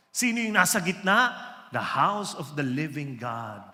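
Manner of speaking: slow, emphatic sermon cadence; building pulpit proclamation; full-force preaching. Acted. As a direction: preach it, drawing out each promise. Preaching about the camp.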